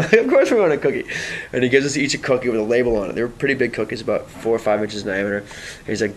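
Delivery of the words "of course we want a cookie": high-pitched keen reply; imitated high enthusiasm; eager acceptance. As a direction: answer as an excited group, pitched up. Group's eager answer, acted.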